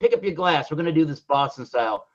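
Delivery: hammed-up Boston accent, fast; a badly faked Boston accent, pushy. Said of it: "That was a bad Boston accent".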